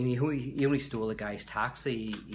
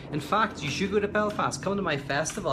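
much higher and louder, eager call to "go to Belfast"; excited invitation; 9 semitones higher, 6 dB louder than usual. Real, not acted. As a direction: pitch it up and call out "you should go to Belfast" with real enthusiasm. Urging viewers to visit Belfast.

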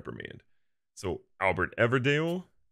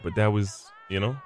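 ordinary talk

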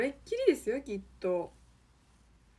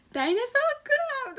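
high fast teasing yell; mocking shout; 12 semitones higher, much faster than usual. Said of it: "とか言われて".

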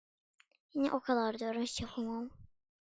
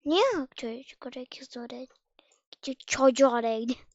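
normal talking voice

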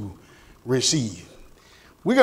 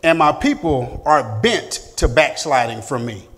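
steady, emphatic Bible reading; louder, declaiming from the pulpit; scripture reading; 7 dB louder than usual. Acted. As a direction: read the verse out to the class with weight. Reads scripture aloud.

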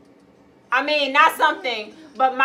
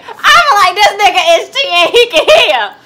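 laughing shout; 5 semitones higher, 14 dB louder, much faster than usual. Real, not acted. Laughing outburst during game.

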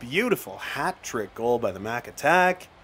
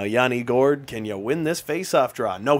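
ordinary talk, nothing marked

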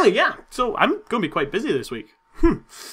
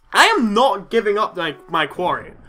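ordinary talk

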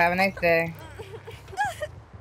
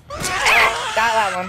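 triumphant shout; 5 dB louder, much slower than usual. Real, not acted. Catching a survivor, shouting.

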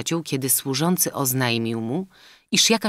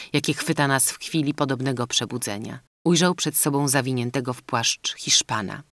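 plain reading voice

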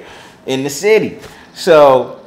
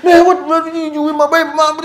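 much higher put-on voice, laughing; mock the angry caller on the phone; hammed-up boyfriend on the phone; imitating Orlando; faster than usual. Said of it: "I get called from Orlando".

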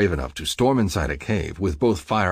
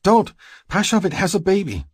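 high, loud woman's plea; calling out urgently; 6 dB louder than usual. A narrator's reading. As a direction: cry out to stop him, high-pitched. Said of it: "Stacy calls to me".

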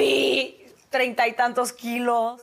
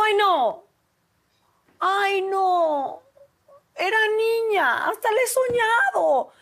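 whiny protest; 10 semitones higher, 3 dB louder, slower than usual. Retold or imitated. Protesting doctor says it's a boy.

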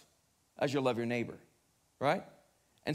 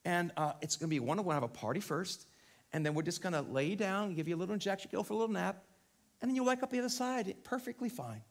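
soothing sales pitch; 4 dB quieter than usual. Acted. Voicing the euthanasia programme's soft sell.

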